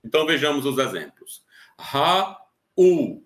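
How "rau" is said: "Ra-u" said slowly as two syllables, ra then u; teacher splits "Ra-u" into ra and u for the class; syllables split apart. Shown as a demonstration. Teacher shows students the split syllables, slowly. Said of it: "Ra-u não é acentuado".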